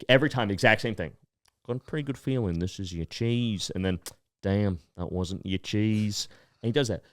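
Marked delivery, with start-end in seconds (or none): 1.6-3.7 soft put-on accent; hushed showman in a put-on accent; Cheese Man accent. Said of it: "I gotta do his accent". 4.4-6.3 slow, low put-on accent; the performer's accent, soft and drawn out; deadpan showman in a put-on accent; Cheese Man accent. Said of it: "I gotta do his accent".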